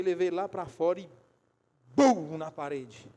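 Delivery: normal talking voice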